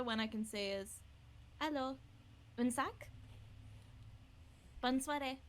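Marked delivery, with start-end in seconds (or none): 1.6-5.4 lightly French-accented greeting; short, separated French greetings in a French accent. Shown as a demonstration. Performer showing friends their French, lightly accented greeting. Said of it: "which is my French".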